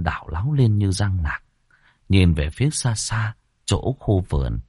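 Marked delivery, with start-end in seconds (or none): none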